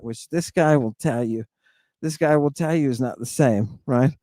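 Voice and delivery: normal talking voice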